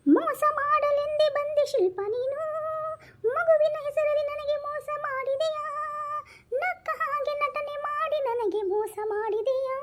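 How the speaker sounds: high, slow mock sobbing lament; melodramatic wailing. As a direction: wail it like a betrayed soap hero. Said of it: "baby, ಅಳ್ಬೇಡಿ".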